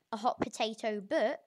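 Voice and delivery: ordinary talk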